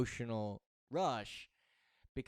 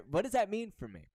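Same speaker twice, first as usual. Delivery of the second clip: higher, louder voice of a confused listener; puzzled audience member; 8 semitones higher, 6 dB louder than usual. Retold or imitated. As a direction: voice the audience's puzzled question with a laugh. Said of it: "You're probably saying".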